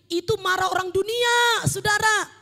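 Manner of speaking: animated, shouted exhortation; full-force preaching. Real, not acted. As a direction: exclaim to the congregation in scornful disbelief.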